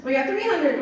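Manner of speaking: usual speaking voice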